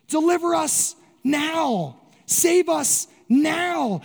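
slow, drawn-out plea for rescue; slow pleading. Retold or imitated. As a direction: draw each word out, begging. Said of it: "The second half of the word is now".

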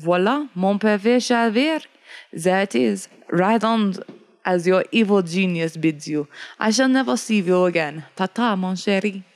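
put-on French accent; French voyager. Voyager leaves Jacob at the ledge, French accent. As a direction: farewell in a light French accent. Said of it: "The voyager, pointing ahead, says".

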